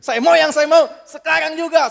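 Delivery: high, loud mock crying demand; hammed-up bawling insistence; tearful tantrum. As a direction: wail it like a spoiled child. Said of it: "Sampai nangis-nangis".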